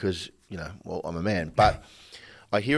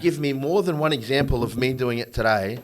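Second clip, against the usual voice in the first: loud, higher, insistent challenge; demanding facts; 6 semitones higher, 5 dB louder than usual. Retold or imitated. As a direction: press her for proof, point by point.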